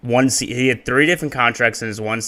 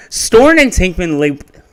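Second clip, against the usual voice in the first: higher, upbeat video narration; YouTuber voice; 3 semitones higher, 4 dB louder than usual. Shown as a demonstration. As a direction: read it like an energetic YouTube narrator. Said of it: "Let's get YouTuber voice going".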